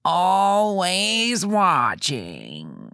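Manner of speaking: very slow, high creepy quote; creepy drawn-out whisper voice. Retold or imitated. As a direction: drag out each word, spooky. Quote repeated, slower.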